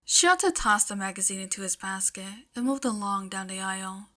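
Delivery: ordinary delivery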